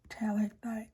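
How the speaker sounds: unvoiced ASMR whisper; soft whisper. Real, not acted. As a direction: breathe the words close to the mic. Whispered ASMR pen chat.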